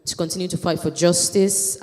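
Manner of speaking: ordinary talk, nothing marked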